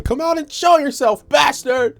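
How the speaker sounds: high-pitched taunting challenge; imitating Nancy yelling at the killer; high mock yell. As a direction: squeal it like an angry girl daring him out. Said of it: "She's like".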